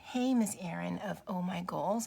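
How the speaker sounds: ordinary delivery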